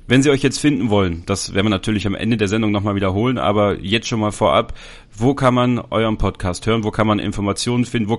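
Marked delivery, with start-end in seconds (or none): none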